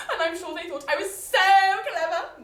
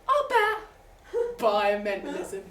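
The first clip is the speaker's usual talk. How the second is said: put-on lower class accent; 4 semitones higher, 6 dB louder, slower than usual. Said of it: "in that disgusting lower class accent".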